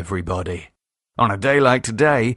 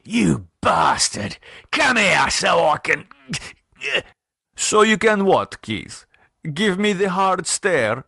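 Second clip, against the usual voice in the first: lightly voiced gangster, higher and mocking; Sergei gloating; 7 semitones higher than usual. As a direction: sneer down at him. Sergei gloating over Keith.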